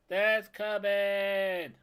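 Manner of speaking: loud, high whooping cheer; excited cheering. Real, not acted. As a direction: cheer it home with a big whoop. Cheering as the landing succeeds.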